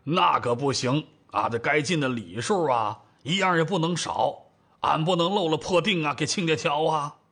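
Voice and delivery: ordinary delivery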